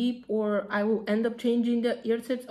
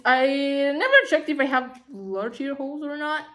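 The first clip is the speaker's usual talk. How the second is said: ordinary talk, nothing marked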